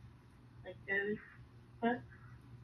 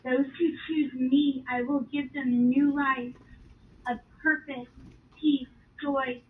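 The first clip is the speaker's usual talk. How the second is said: slow, louder, earnest proclamation; solemn divine promise; 3 semitones higher, 8 dB louder, slower than usual. Retold or imitated. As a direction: promise it like a loving God. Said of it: "He says".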